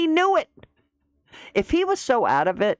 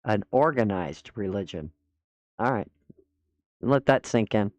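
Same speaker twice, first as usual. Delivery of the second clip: low, deadpan sarcastic repeat; sarcastic mock; 10 semitones lower than usual. Host mocking a guest's excuse about an organized debate, low deadpan.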